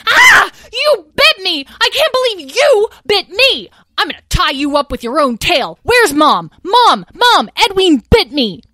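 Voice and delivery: high, loud furious shrieking; outraged shriek. Acted. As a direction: scream for mom, outraged. Bitten, calls for mom.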